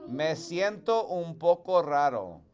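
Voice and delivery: teacher modelling "Me siento un poco raro"; Spanish model phrase. Shown as a demonstration. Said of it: "repeat after me".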